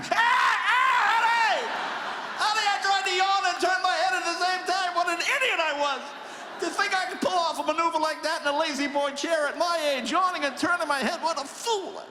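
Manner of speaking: put-on high, loud whiny old man; pained old man. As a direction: moan to honey in pain. In pain, calling wife.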